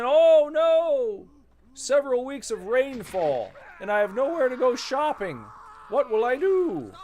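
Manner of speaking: high, loud, melodramatic wail; theatrical high-pitched lament; mock despair. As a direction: ham up the shopper's panic. Mocking mall-goers in rain.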